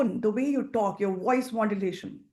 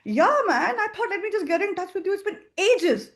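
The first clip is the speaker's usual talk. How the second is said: higher and louder, with a laugh; energetic, upbeat; 8 semitones higher, 4 dB louder than usual. Shown as a demonstration. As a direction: catch up with an old friend gladly. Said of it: "You see the tone, the energy?".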